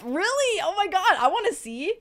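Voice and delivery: high, loud, eager reaction; excited delight. Real, not acted. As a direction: squeal with interest at the news. Delighted by viewer's tattoo news.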